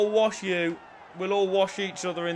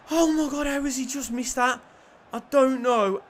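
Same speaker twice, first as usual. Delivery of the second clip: high, raised, incredulous voice; stunned disbelief; 7 semitones higher, 5 dB louder than usual. Real, not acted. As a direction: gasp out relief and disbelief at the miss. Reacting to a miss.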